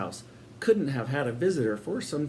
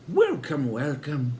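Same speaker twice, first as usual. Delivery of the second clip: sinister hostess; slower than usual. A narrator's reading. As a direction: greet them grandly, a touch too sweet. Sister greets the boys grandly.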